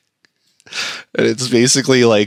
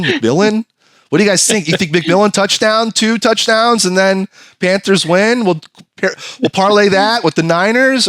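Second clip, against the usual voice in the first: higher, eager planning; hyped streamer; 7 semitones higher than usual. Retold or imitated. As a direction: hype the chat. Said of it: "he's like".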